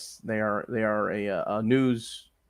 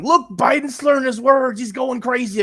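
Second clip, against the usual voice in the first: higher, louder mock gloating; imitating an excited partisan; 12 semitones higher, 6 dB louder than usual. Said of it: "I'm gonna see Republicans going".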